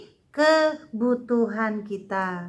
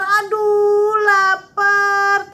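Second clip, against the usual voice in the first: put-on slow, whimpering hungry child, higher and louder; mock crying; 6 semitones higher, 5 dB louder, much slower than usual. Teacher showing kids a hungry child crying, slowly whining. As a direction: act out a hungry kid about to cry. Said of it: "Siapa yang kalau terlalu lapar nangis?".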